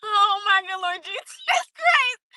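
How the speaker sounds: high exclamation broken by laughing; crying with laughter. Real, not acted. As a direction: gasp it out between laughs. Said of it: "I'm sitting here crying".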